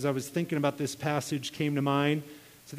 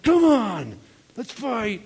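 louder, higher mock fighting shout; imitated bully's challenge; 6 semitones higher, 7 dB louder, slower than usual. Bully daring the new kid to fight, mock shout. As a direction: goad him like a schoolyard bully.

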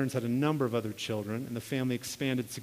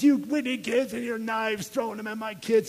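neighbor mom's higher, scolding mock yell; retold angry yell; 6 semitones higher than usual. Neighbor mom storming back at the kids, mock yelling. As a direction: imitate an outraged mother telling kids off. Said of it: "Her mom yelled at us".

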